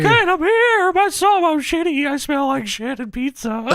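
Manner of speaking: high falsetto squeal; Paul Bearer. Acted. Wrestling manager tells Kane his woes, high mock squeal. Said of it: "Paul Bearer says".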